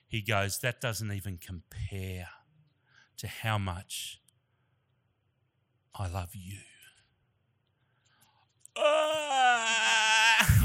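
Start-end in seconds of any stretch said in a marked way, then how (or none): 0.6-6.6 slow, low, soft and weighty; tender voice of God. Retold or imitated. God telling a new father of his love, slow and tender. 8.7-10.6 loud, high, long comic wail; mock bawling. Preacher acting out himself bawling in the car, hammed-up loud wail. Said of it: "I'm just bawling my eyes out".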